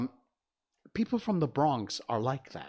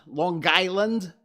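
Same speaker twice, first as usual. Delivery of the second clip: loud, slow, higher "Lawn Guyland" local accent; Long Island accent; 7 semitones higher, 7 dB louder, slower than usual. Shown as a demonstration. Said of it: "as they say".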